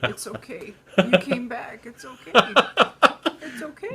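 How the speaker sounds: pat him on the back gently; mock comforting. Mock consoling the co-host.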